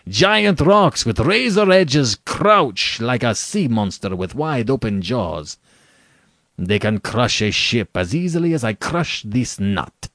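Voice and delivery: slow, relishing, menacing General; gloating General. Acted. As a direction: savor each danger, starting loud and high.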